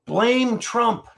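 high-pitched mock chant; mocking Democrats. Host answers what their playbook is, high mocking parroting. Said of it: "What is their playbook?".

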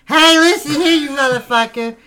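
loud high mock fury; angry yell. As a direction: shout him down. Angry defense of mother.

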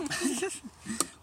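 high burst of laughter; sudden high real laugh. Real, not acted. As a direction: crack up at the joke. Laughing at friend's joke.

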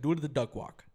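loud mock command; brusque Russian lady. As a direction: imitate a curt clinician barking orders. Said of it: "This fucking Russian lady comes in".

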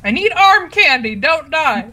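high, loud call, laughing at the end; calling from far away. Acted. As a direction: shout it over with a laugh. Yelling to ally in battle.

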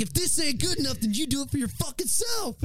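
yelling in full rage, voice cracking up high; furious yell. Retold or imitated. Retelling yelling at his boss before quitting, furious high yell. Retold blowup at boss with shovel.

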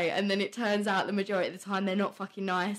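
usual speaking voice

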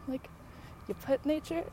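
ordinary talk

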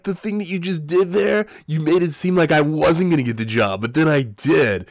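high, gushing amazement; legitimately excited. Retold or imitated. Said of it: "I was legitimately excited".

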